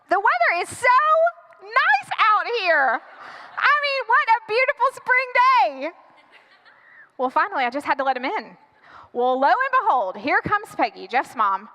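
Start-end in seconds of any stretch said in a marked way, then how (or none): 0.1-5.9 false cheer. Acted. Girlfriend keeps dad outside, falsely cheery. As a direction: gush to stall for time. Stalls with weather small talk.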